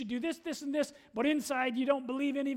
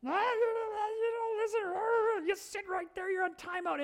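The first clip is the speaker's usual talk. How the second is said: put-on high, whiny rant as an exasperated babysitter; mock angry yelling; 8 semitones higher than usual. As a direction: imitate a high-pitched scolding at a toddler. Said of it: "she started yelling at this little guy".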